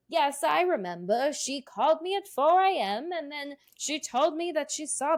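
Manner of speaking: high-pitched mock witness reciting a story; hammed-up witness testimony. Podcaster mimics an unrealistic witness telling a long-winded story, hammed-up. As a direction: imitate an over-dramatic witness, pitched well up.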